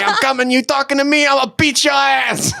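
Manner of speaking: hammed-up Boston accent threatening a friend; mock Boston tough guy. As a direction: play a Boston tough picking a fight. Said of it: "came back with that accent".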